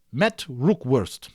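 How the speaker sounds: plain reading voice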